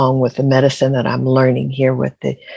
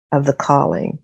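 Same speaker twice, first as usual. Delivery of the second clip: ordinary talk, nothing marked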